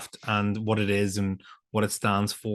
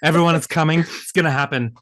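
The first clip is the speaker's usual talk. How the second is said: louder, building excitement; mock hype; 5 semitones higher, 5 dB louder, faster than usual. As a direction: hype up the sneeze.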